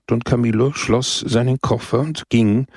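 ordinary delivery

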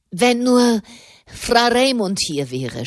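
mild groan of despair, starting high; a half-hearted moaning wish; groaning lament. Acted. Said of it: "stöhnte er". (leicht gestöhnter Wunsch)

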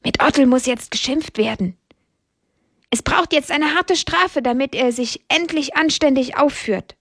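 mildly indignant hen; indignant murmuring. A narrator's reading. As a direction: confide disapproval to the other hens. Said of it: "raunt die Henne Lydia zu den anderen Hennen".

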